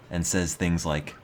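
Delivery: ordinary talk, nothing marked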